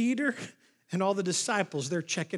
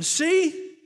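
high, loud call for attention; mock-proud Peter showing off; showy boast; 9 semitones higher, 7 dB louder than usual. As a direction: pipe up loudly, pleased with yourself. Acts Peter boasting to Jesus.

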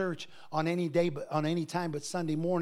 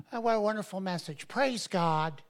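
mock churchgoer; 2 semitones higher than usual. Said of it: "you're thinking".

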